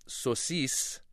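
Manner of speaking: sosis said slowly as SO-sis; careful Farsi sosis with long vowels. Shown as a demonstration. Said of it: "in Farsi".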